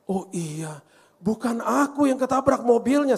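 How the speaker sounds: high, drawn-out mock wail; plaintive retold cry, rising sharply; imitated crying child. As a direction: play a child bursting into tearful explanation. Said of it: "Dia tiba-tiba nangis".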